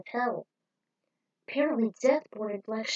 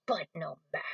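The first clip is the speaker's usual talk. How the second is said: soft, lower whispered aside; dismissive whisper; 5 semitones lower, 6 dB quieter, faster than usual. Acted. Villain brushes off the loss, a low whisper. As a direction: brush it off under your breath.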